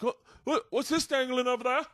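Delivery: high, half-laughing clueless Kiwi voice; mock Kiwi instructor. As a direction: squeak it like a careless Aussie jumpmaster. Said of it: "Kiwis and Australians are constantly".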